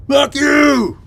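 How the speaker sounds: loud, high-pitched yelled curse; retold angry shout. Mike turns on the driver pulling over, imitated angry shout. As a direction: yell it back down the road, imitating him. Retells Mike turning round and swearing.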